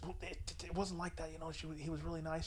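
hushed protest. Retold or imitated. Girlfriend defending her date to her mom, hushed. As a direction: protest quietly, so he can't hear. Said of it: "Why are you whispering?".

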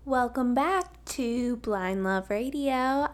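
ordinary talk, nothing marked

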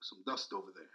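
a low, soft offhand king's aside; mild royal nonchalance; lazy king remark. Retold or imitated. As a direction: drop it low like a king who won't lift a finger. King casually pointing out dust.

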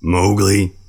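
put-on loud stern call of the boy's name; angry bear. Angry bear pins the boy and calls his name loudly. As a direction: scold him with his name. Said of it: "the bear was angry".

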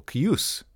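"Kius" stressed on the OOS; kee-OOS, rising on the last syllable; second-syllable stress. Shown as a demonstration. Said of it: "emphasis on the second syllable".

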